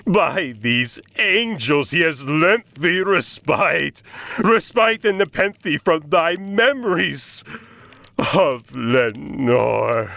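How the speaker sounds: high, rising desperate plea; a poet cracking under grief; anguished pleading. Acted. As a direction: beg for forgetting, voice climbing. Agonized pleading to raven.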